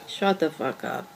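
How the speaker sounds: low, soft, slow disbelief; can't believe what she just heard; stunned hushed disbelief. Acted. Girlfriend hearing he invented her lover, stunned and hushed.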